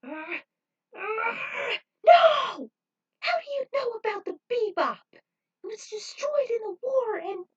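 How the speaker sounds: high, loud, near-crying outburst; Trudy shocked her old crew is known, voice high and crying; outraged disbelief. A narrator's reading. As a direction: break into a shocked, tearful cry. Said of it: "Trudy became outraged".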